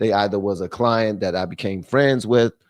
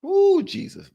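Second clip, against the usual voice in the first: post-sneeze exclamation; slower than usual. Real, not acted. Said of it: "I'm trying not to sneeze".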